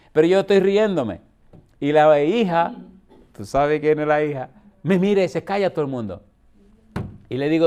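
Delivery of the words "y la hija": ordinary delivery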